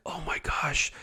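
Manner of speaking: hushed, stunned realisation; awed whisper. Retold or imitated. Man realising who Jesus was, awed whisper. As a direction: start in a whisper, then slow and amazed.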